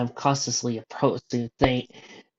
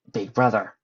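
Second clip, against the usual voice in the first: lightly rasped creature voice at usual pitch; a rough breath, then a hint of raspy monster; 4 dB louder than usual. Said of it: "saying in a very raspy voice".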